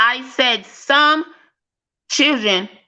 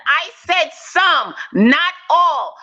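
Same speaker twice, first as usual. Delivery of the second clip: "some" drawn out slow and high, against "all"; stress on some; 4 semitones higher, much slower than usual. Real, not acted. In the debate she insists on some, not all, stressing "some". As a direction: lean hard on "some", slowly. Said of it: "All I said was some".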